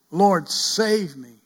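loud slow pleading prayer; calling out to God in desperation; crying out. Acted. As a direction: plead loudly to the Lord. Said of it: "Cry out".

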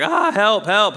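mock panicked calls for help; cry for help. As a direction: squeal for help like a tiny bird. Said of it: "she's just talking like".

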